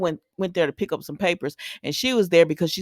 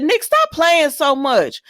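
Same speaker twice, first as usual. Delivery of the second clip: high, loud, slow scold at the screen; 8 semitones higher, 4 dB louder, slower than usual. Real, not acted. Viewer scolds Nick on the soap, loud, high and slow. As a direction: tell him off slowly and loudly. Yelling at TV character.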